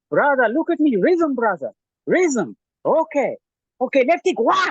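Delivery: put-on very high, loud South African trainer accent. Pad trainer urging rhythm, loud high South African accent. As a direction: hype them up like a South African coach. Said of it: "more Nelson Mandela kind of accent".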